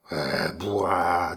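slow guttural growl. Acted. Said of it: "un rauquement gronda dans sa gorge".

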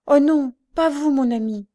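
high, loud protest; emphatic denial. A narrator's reading. Blind woman protesting to her friend, emphatic denial. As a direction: cry out a heartfelt denial. Reassures him in acted dialogue.

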